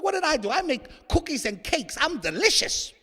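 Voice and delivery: high-pitched, put-upon gluten voice; wronged gluten. Acted. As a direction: plead innocence in a high mock voice. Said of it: "Gluten's like".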